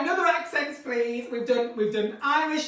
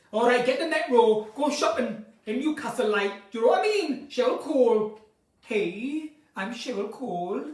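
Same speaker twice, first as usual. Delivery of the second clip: Newcastle accent; Geordie accent. Shown as a demonstration. Shopping in Newcastle as [name], Geordie accent. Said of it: "That's Newcastle, not Jamaican".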